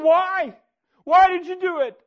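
desperate retold shout at God; anguished yell. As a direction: shout the question up at the sky. Crying out at God in field.

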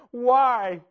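crying out at God in grief; mild retold scream at God; retold anguished yell. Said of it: "yelling at the top of my lungs and screaming at him".

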